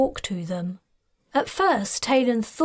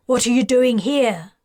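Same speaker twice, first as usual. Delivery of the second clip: louder stern officer voice; 5 dB louder than usual. Acted. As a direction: challenge like a suspicious officer. Officer challenging suspected guard.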